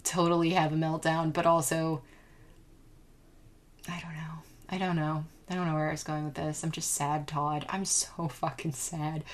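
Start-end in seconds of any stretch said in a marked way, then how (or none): 3.9-4.4 barely audible sigh of words; whispered. Real, not acted. Sad admission, voice drops. 4.7-9.2 sad. Real, not acted. Admits being sad.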